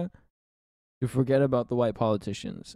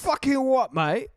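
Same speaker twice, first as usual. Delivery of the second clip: high, hammed-up British geezer; British accent; 10 semitones higher than usual. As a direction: bark it like a London lad. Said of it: "mocking British way of talking".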